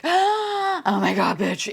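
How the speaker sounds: imitating viewers gasping at the look; amazed gasp. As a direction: gasp then high stretched oh my God. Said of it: "people were just like".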